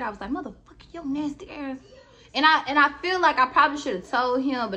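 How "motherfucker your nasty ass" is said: inner disgusted voice. Retold or imitated. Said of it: "in my head".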